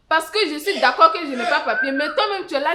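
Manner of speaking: heated accusation building to loud shouting; angry retort. Acted. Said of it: "Ne soyez pas en colère comme ça". (riposte en colère, de plus en plus criée)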